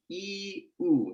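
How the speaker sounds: "ee" then "oo", held vowels; drawn-out sample vowels, lips rounding at the end; vowel demo. Shown as a demonstration. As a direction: move from front "ee" to rounded back "oo". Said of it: "going from ee to oo".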